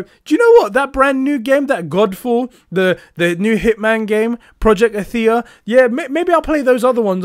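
high, eager imitation of a gamer; mock enthusiasm. Imagined player choosing brand new games over GTA five, mock enthusiasm. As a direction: play the keen customer, pitch jumping up at the start. Acting out an enthusiastic game buyer.

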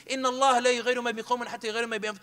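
fast rattled-off Quran verse; angry quick recital; rushed recitation. Retold or imitated. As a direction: fire the ayah off in one breath. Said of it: "I even used an ayah".

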